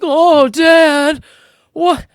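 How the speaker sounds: high, loud mock kid whine; whining. Kids told to do push-up position, whining. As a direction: imitate a kid protesting to a parent. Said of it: "even if they do whine about it".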